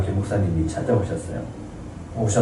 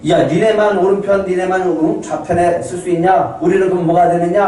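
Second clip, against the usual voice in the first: higher, louder indignant complaint; angry disciples; 7 semitones higher, 3 dB louder than usual. Retold or imitated. Disciples angry at the brothers, indignant and raised. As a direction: whine like jealous disciples left out. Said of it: "제자들이 막 화를 내요".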